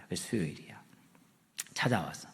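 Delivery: hushed whisper. Real, not acted. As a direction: lean in and whisper the reveal. Preacher drops to hushed reveal.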